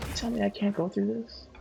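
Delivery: put-on high voice, questioning; high, mock-incredulous question; high-pitched repeat.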